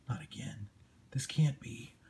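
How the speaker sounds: breathless scared whisper. Acted. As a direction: hush it out in despair. Desperate comic panel line read aloud.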